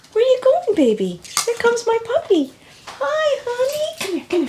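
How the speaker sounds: cooing at a puppy. Real, not acted.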